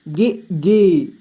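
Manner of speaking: "गि" kept short, "गी" held long and slow. Shown as a demonstration. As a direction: show the short then the long vowel. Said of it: "छोटी ई में कम समय में ... बड़ी ई को ज़्यादा समय में". ("गि" छोटा, "गी" खींचकर लंबा)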